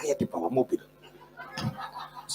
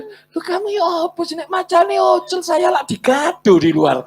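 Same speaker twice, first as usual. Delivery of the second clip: high, loud, exasperated outburst; acted angry protest; 14 semitones higher, 9 dB louder than usual. Husband scolds wife for leaving him with tiger, exasperated. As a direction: protest loudly at being left outside.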